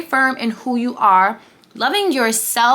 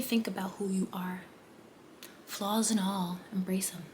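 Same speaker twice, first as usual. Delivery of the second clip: unvoiced whisper, much softer; 5 semitones lower, 17 dB quieter than usual. Real, not acted. Whispering advice to the viewer. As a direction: hush it out like a secret.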